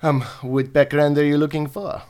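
badly faked French accent. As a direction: ask it as a French-accented elf commander. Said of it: "We're gonna give him a French accent".

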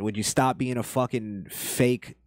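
ordinary delivery

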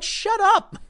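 high, snappy mocking retort; mocking shut up. To his family online, a high mocking dismissal. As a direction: mimic them brushing him off.

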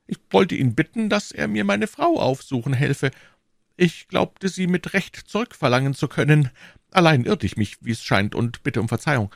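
high, apologetic shopkeeper voice; contrite prisoner. Acted. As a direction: admit it meekly, pitched high. Voices Bonacieux admitting fault.

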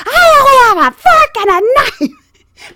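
slow, high, loud mimicked wail; yelling old lady. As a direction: holler it as a hard-of-hearing passenger. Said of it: "are yelling about the exact".

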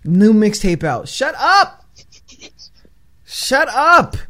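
loud, very high-pitched mock outrage; fed-up, high-pitched heckling; exasperated rant. Real, not acted. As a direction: shout it down in comic disbelief. Mock rant at movie mixtapes.

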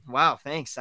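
dry sarcastic wow thanks. Retold or imitated. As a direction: say it with biting sarcasm. Sarcastic reaction to bland call.

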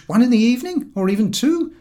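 a jolly beer-lover lays down his rule; George's voice. A narrator's reading. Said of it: "said George".

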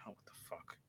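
barely voiced, deflated aside; imitated disappointment, almost whispered; let-down reaction. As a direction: act out quiet disbelief under your breath. Acts out a let-down viewer's reaction.

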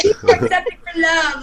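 high, loud mocking singsong of the advice; sarcastic imitation of a self-help author; mock sweet voice. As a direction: parody the book's gooey tone, up high and loud.

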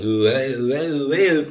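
hammed-up "ooh, eh" impression; goofy performer noises. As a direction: imitate a silly act with loud nonsense syllables. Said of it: "One of the guys going".

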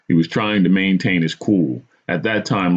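ordinary delivery, nothing marked

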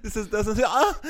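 breaks into high shrieking laughter; can't finish for laughing. Real, not acted. Collapsing into laughter.

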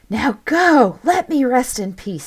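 loud, high screech; screeching spirit. A narrator's reading. Spirit to intruders at its tomb, screeched command. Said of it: "screeched the spirit".

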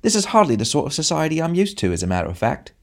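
high, prissy society voice; short man sniffing at the company; snobbish complaint. A narrator's reading. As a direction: look down your nose at them. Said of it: "said the short man".

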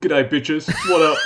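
broad Aussie bloke greeting the room; real voice. Pretend. Asked for his real voice, he greets them in an Australian accent. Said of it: "I'd just like to hear [name]'s real voice".

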